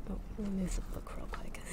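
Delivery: quiet whispered aside; breathy off-mic whisper. Real, not acted. Aside while moving book.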